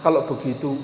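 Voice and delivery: low, soft acted prayer; praying husband. Husband prays for a house while wife cries, hushed humble prayer. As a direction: plead quietly to God. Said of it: "suaminya berdoa".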